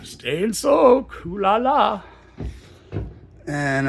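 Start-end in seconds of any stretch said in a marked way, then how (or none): 0.0-2.0 high, slow teasing ad voice; playful spa pitch, drawn out high; mock bathhouse ad. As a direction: sell the soak like a cheesy spa ad.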